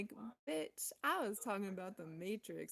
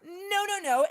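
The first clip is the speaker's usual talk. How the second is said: high, loud, tearful no no no; crying no; 10 semitones higher, 11 dB louder than usual. Real, not acted. Refusing victim mentality to the viewer, high tearful crying. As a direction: cry out the refusal through breaking tears.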